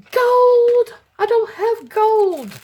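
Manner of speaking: gasp, then a high, loud excited squeal; high-pitched, loud delighted surprise; real excitement. Real, not acted. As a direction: burst out in thrilled disbelief, voice shooting way up. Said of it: "I get so excited. I notice my voice changes".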